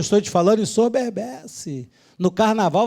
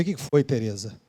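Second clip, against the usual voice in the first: much softer, lower, caring question; tender concern; 7 semitones lower, 9 dB quieter than usual. Retold or imitated. Pastor retelling comforting a crying Terezinha, soft and caring. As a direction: ask gently what is wrong. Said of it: "Eu falei".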